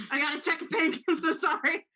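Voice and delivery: high, loud, laughing fluster; real laughing apology. Real, not acted. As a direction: apologise through a burst of laughter. Player breaks off mid-game, laughing.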